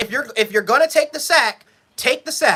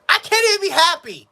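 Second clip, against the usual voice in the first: raised, higher and frustrated; exasperated outburst; 7 semitones higher than usual. Real, not acted. Fan to viewers after a sloppy touchdown, exasperated outburst. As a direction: throw it out in disbelief.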